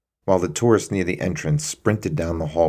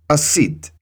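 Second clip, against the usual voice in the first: curt guard remark; 10 semitones higher, 5 dB louder than usual. Acted. Senior guard over fallen man.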